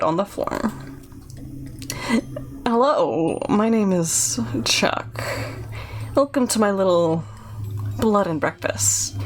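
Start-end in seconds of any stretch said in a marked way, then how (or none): 2.7-9.2 halting, odd welcome from a sinister host; creepy innkeeper. Acted. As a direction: greet a guest like an eerie, awkward innkeeper. Voices Chuck, creepy innkeeper.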